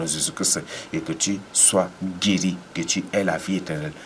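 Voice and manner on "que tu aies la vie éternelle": ordinary delivery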